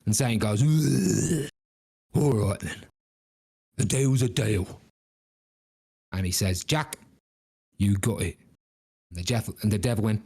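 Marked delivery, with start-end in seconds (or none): 0.6-4.8 grudging Cockney devil; English accent. Acted. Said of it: "our evil folk have English accents". 6.6-8.4 devil's put-on English accent. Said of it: "our evil folk have English accents".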